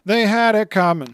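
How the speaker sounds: loud, high agreeing echo; emphatic echo. Real, not acted. Agreeing loudly with co-host's joke. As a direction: belt out the agreement.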